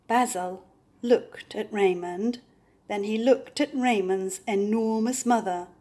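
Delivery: ordinary delivery, nothing marked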